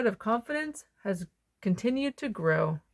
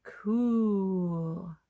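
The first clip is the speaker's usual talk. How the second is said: hushed cool. Acted. Echoing cool after each talent.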